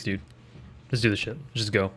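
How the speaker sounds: ordinary talk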